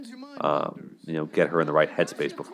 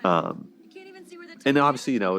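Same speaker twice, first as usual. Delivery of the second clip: ordinary talk, nothing marked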